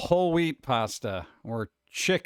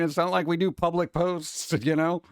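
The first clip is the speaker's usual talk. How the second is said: normal talking voice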